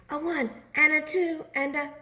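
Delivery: high lightly voiced count; a high count-in to lift together.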